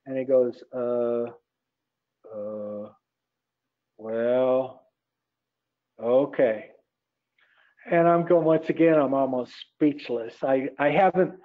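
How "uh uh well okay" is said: lower, slow, long pauses between single words; lightly voiced laconic rancher, dragging it out; hint of a slow-talking cowboy giving in; slow taciturn rancher. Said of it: "he talks very slowly".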